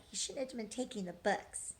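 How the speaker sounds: plain reading voice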